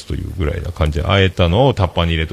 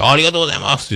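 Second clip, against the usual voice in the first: higher-pitched re-enacted thank-you; acted eager thanks; 9 semitones higher, much faster than usual. Employee thanking boss for a day off, acted eagerness. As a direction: act out grateful delight.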